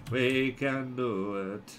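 low, slow, quiet self-rallying; drawn-out encouragement. Real, not acted. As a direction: stretch each word of "We can do it".

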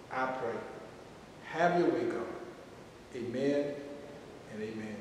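hushed prayer for listeners to repeat; guided prayer. Acted. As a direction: lead the prayer gently, phrase by phrase.

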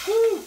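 excited woo. Real, not acted. Excited at the cooking sizzle.

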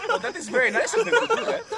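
laughing. Real, not acted. Laughing at card.

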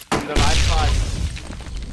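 slow, loud urgent callout. Real, not acted. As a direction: drag out the warning loudly. Callout under fire.

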